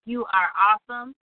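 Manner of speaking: usual speaking voice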